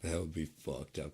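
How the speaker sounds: normal talking voice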